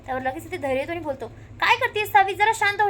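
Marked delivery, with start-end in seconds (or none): 1.6-2.9 higher, louder calling out; raised, firm calming call; urgent call. Retold or imitated. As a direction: call out to calm her down. Dhairya calms frantic Savi.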